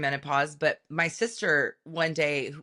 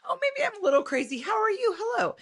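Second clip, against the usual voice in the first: much higher, frantic nervous chatter; anxious character; 8 semitones higher than usual. Acted. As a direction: play a jittery bundle of nerves, rushing questions. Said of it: "I stayed in character".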